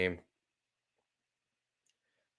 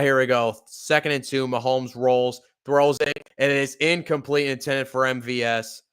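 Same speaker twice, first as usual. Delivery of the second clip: play-by-play call; 2 semitones higher, 4 dB louder than usual. Acted. Fan calling the game live.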